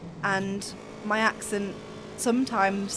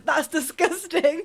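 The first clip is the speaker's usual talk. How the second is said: slow, higher, drawn-out mock revulsion; mock disgust; 5 semitones higher, slower than usual. Everyone hearing she's from Birmingham, mock disgust. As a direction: imitate an appalled stranger, stretching the words.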